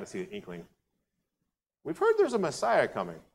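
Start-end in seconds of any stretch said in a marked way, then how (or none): 1.8-3.2 hinting woman. Retold or imitated. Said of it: "And then she goes".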